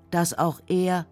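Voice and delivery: ordinary talk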